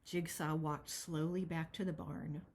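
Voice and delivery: ordinary delivery, nothing marked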